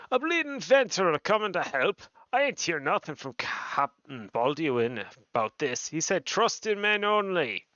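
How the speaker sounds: indignant colloquial grumble in character; scornful soldier. Acted. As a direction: play a dismissive, irritated game guard. Voices a grumbling game character.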